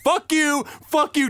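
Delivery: high mock yelling; imitated angry cursing. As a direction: spit it at them from the balcony. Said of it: "He's like".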